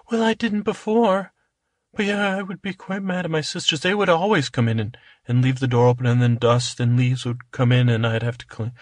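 high, chatty princess. Acted. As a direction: complain lightly in a high princess voice. Cinderella replies.

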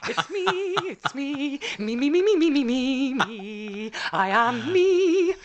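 high, slow grand diva; sing-song self-adoring diva. Pretend. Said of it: "She's such an actress".

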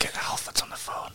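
hushed joking whisper; breathy stage whisper. Acted. Whispered joke aside.

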